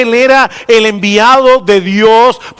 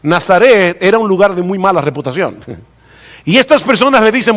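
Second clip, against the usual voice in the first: normal talking voice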